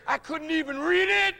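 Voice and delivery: fiery sermon shout; preaching at full force. Acted. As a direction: hammer each phrase at the crowd. Preaching peak.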